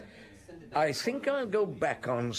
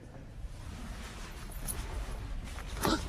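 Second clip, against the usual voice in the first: shocked gasp; 8 dB quieter than usual. Acted. Finds she is dead.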